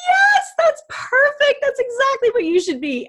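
a mentor delighted by her dream, thrilled and high; delighted yes. Retold or imitated. Imitating the doctor's delighted approval.

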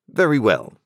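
a crisp, authoritative London lawyer; stern lawyer voice. A narrator's reading.

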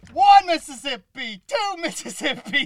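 high, slow drawn-out counting; slow evil count. Acted. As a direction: stretch each number out, teasing. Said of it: "Oh, that's so slow".

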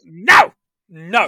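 loud hammed-up no; mock shout. Podcaster mimicking the kid refusing tablets, hammed-up shout. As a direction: yell it like a bratty refusal.

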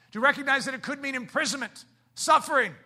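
fast, high, loud preacher build; preaching at full force. Acted. Preacher warning the church what following Jesus costs. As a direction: drive the cost home to the listeners.

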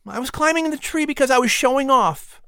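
put-on high boy's voice; boy owning up; admitting Patrick. A narrator's reading. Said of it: "Patrick admitted".

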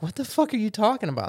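quick, high-pitched mock disbelief; baffled retort. Party answers goblin calling them king, baffled and incredulous. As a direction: rush it out, totally baffled.

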